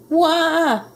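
high-pitched mock baby cry; baby ghost's wail. A narrator's reading. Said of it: "yelled the baby ghost".

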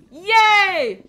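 very loud, high cheer; joyful yay. Real, not acted. Streamer cheering as the scan finally works, very loud. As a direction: burst out in delight.

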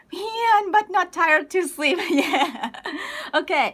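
ordinary talk

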